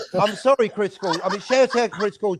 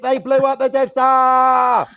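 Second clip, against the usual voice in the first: emphatic shout; 8 semitones higher, 6 dB louder, slower than usual. Real, not acted. Emphatic punchline about Star Wars.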